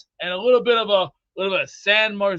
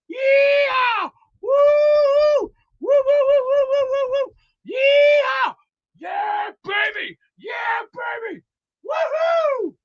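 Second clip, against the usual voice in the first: very high falsetto hollers; cowboy whooping; much slower than usual. Pretend. Celebrating the Cowboys, high-pitched whooping. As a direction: let loose with long woos and yeahs. Cowboy hype act continues.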